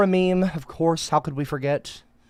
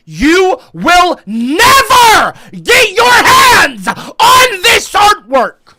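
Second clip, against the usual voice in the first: slow, much higher, full-throated yell; defiant shout; 13 semitones higher, 8 dB louder, much slower than usual. Real, not acted. Streamer yelling at chat that they'll never get his artwork, full shout. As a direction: bellow it at the chat, daring them.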